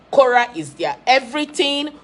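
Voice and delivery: ordinary delivery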